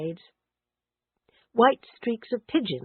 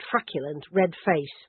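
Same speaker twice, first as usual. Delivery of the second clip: usual speaking voice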